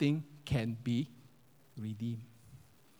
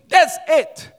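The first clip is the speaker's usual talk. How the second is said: loud, high-pitched explosion; table-banging outburst; 12 semitones higher, 12 dB louder than usual. Retold or imitated. Husband banging the table, acted loud outburst. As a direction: burst out as the fed-up husband. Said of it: "the husband banged the table".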